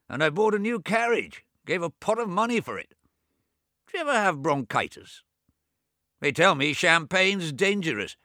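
put-on high, loud, testy old man in bed; a cranky old man scolding his brother; irritable old invalid. Bedridden Swithin chiding his deaf twin, high and testy.

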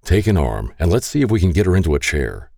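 plain reading voice

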